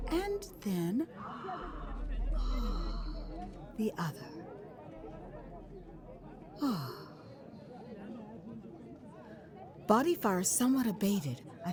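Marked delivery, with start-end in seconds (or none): 1.1-3.7 a drawn-out sigh of relief that rises at the end; long relieved sigh. Retold or imitated. Cooling off in the heat. 9.9-10.1 sigh, then barely voice the word. Retold or imitated. Cooling off in the heat.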